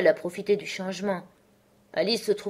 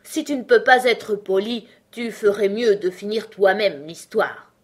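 louder, higher huffy complaint; sullen; 3 semitones higher, 7 dB louder than usual. A narrator's reading. Dormouse telling Alice to finish it herself, sullen. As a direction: object crossly, in a sulk. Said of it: "le Loir observa d'un ton maussade".

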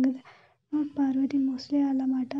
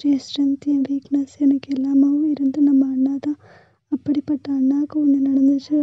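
loud, pleading, near tears; loud emotional outburst; 9 dB louder than usual. Acted. Older sister loudly pleads with Aishu about brother's pain, near tears. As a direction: pour it out loud to your little sister.

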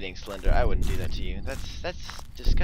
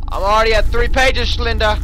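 loud, high taunting call; defiant shout; 5 semitones higher, 9 dB louder than usual. Real, not acted. Player boasting to Slender about pages, defiant shout. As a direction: holler it out at the monster.

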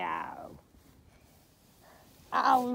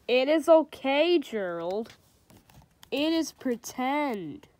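storybook reading with light character voices; reading to children; 5 dB louder, slower than usual. A narrator's reading. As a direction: read it like a bedtime picture book. Reads a picture book aloud.

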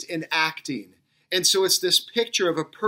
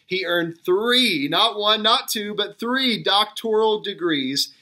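"three", "not one", "not two" punched louder and higher; building emphasis; 5 semitones higher, 3 dB louder than usual. Real, not acted. Preacher marvelling at the professor's degrees, with rising emphasis. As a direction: build it up, hitting each number.

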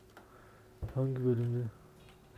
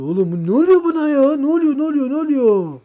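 much higher, loud, disbelieving cry; shocked shout; 13 semitones higher, 12 dB louder than usual. Real, not acted. Gamer baffled by the game going wrong, shocked shout. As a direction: yell in alarmed disbelief.

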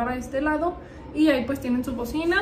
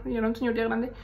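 ordinary talk, nothing marked

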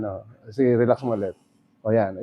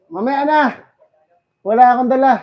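calling out; 12 semitones higher, 5 dB louder than usual. Real, not acted. Calling out to someone off-mic.

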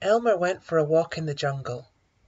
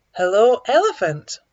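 loud, higher hello; animals' greeting; 4 semitones higher, 9 dB louder than usual. A narrator's reading. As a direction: call out to a passing stranger. Animals greet the unrecognized elephant.

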